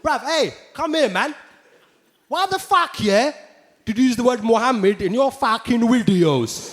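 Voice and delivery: angry London roadman. Retold or imitated. Angry fan confronting the comedian, roadman aggression. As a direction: square up like a street lad. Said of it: "People were coming up to me".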